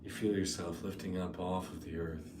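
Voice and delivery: soft, unhurried meditation voice with long pauses; guided meditation. Real, not acted. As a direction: ease them gently into the imagery.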